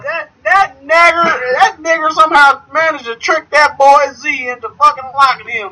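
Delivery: high, exaggerated indignant townsperson; mock Southern local. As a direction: keep up the caricatured Southern gossip. Continuing mock Southern townsperson routine.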